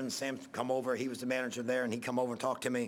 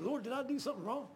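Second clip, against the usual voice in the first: anguished plea; 10 semitones higher, 4 dB quieter, faster than usual. Retold or imitated. Preacher's anguished prayer retold.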